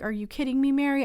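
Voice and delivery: ordinary delivery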